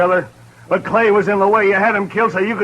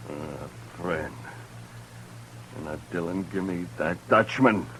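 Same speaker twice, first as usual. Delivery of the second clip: much softer and lower, bitter and threatening; low menace; 11 semitones lower, 12 dB quieter than usual. Acted. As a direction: lean in and threaten under the breath. Accuser confronts marshal.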